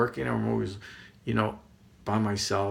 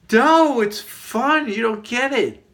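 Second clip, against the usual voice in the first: high, loud, insistent objection; excited protest; 11 semitones higher, 8 dB louder, slower than usual. Retold or imitated. Arguing back at companion who says stop, excited protest. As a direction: protest loudly, pitch way up.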